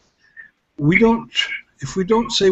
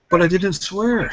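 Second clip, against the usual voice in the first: higher, slow imitation of a protester; imitated stubborn insistence, pitched up and drawn out; mock stubborn protest; 5 semitones higher, slower than usual. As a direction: stretch out the same old excuse, mockingly. Retold man repeating his one excuse.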